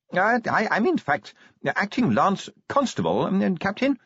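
small stammering voice, courteous and insistent; polite yet firm. A narrator's reading. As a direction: politely correct a superior, hesitant but firm. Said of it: "politely yet firmly".

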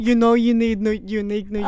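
loud put-on New York accent. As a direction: push it like a brash New Yorker. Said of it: "My New York accent is not on it today".

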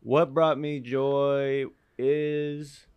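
slow, drawn-out grand intro; mock announcement. Starting his joy story, slow mock-grand announcer intro. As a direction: stretch it out like an announcer. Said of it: "slow announcing voice".

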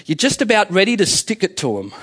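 usual speaking voice

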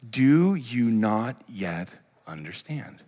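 drawn out very slowly; slow rebuking question. Retold or imitated. Jesus questioning his disciples, slow and pointed. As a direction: let the question hang slowly. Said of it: "he said to them".

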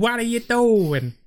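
high, drawn-out New York drama queen; exaggerated New York accent. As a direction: ham up a slow, high-pitched old New York accent. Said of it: "Tallulah was pushing it".

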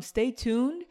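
ordinary talk

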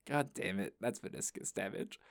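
low, soft, dejected grumble; resigned muttering. Acted. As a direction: sink into a quiet, resigned curse. Acted reaction to finding the brace.

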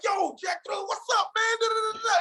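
high shouted greeting across the hall; Nick's excited shout. Retold or imitated. Nick bursting out the door, shouting a high excited greeting. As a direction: call out like you're thrilled to see him. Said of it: "He's like".

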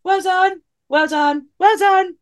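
high chanted well done; repetitive marshal. Shown as a demonstration. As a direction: rattle it off like a marshal. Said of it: "when you say it over and over again".

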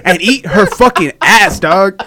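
loud mock public announcement, laughing; mock PSA. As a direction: preach it like a service announcement. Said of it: "P S A".

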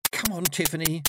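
coaxing encouragement; urging. A narrator's reading. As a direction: egg a friend on. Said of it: "Dimity urged".

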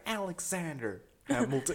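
high mock parent calling the full name; scolding parent. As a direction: play an exasperated American parent. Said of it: "and then they're like".

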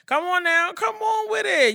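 high, loud cheer; hype cheer. Real, not acted. Host hyping co-host's prayer message, high and loud. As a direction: egg her on like church.

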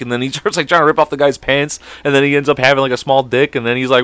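ordinary delivery

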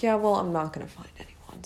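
soft, near-whispered resignation; resigned aside. Retold or imitated. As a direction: let it fade into a defeated whisper. Resigned reply to sympathetic teacher.

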